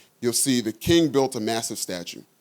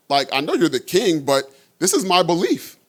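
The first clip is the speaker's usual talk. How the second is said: rising, firm voice that gets higher and louder; defiant believers; 5 semitones higher, 3 dB louder than usual. Retold or imitated. Three young men defying the king, rising firm resolve. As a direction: stand your ground and build to it. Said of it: "They told him essentially".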